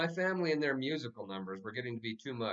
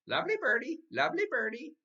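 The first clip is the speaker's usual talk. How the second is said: very high squawking bird voice; mynah bird; 14 semitones higher, 5 dB louder than usual. A narrator's reading. The mynah bird greeting everyone in French, high bird squawk. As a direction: squawk it like a parrot. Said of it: "Uncle Feather said".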